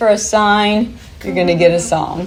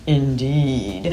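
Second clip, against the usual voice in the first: low, softer, oddball imitation; deep silly voice; 5 semitones lower, 5 dB quieter than usual. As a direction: put on a man's deep, silly voice, quietly. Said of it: "in that deep, silly voice".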